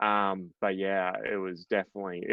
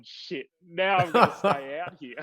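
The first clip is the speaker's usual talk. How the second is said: laughing through the curse; amused curse with a laugh; 7 semitones higher, 4 dB louder than usual. Retold or imitated. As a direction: chuckle it out in happy disbelief. Retold golf curse, laughing.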